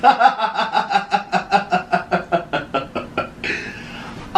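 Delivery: high helpless laughter. Real, not acted. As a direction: burst out laughing. Laughing at her own joke.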